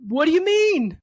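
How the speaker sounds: high, loud, caught-off-guard question; stunned disbelief. Retold or imitated. Retelling husband's grateful remark.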